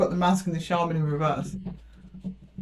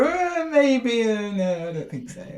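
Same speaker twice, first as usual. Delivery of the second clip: hesitant refusal; 5 semitones higher, slower than usual. Acted. Acts out wavering answer to Pisces.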